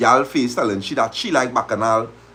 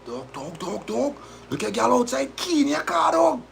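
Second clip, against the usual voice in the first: high, urgent alarm from a partygoer; mock panicked shout; imitating frantic friend; 12 semitones higher, 6 dB quieter than usual. Friend runs to warn the boyfriend, mock panicking. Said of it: "a man run and say".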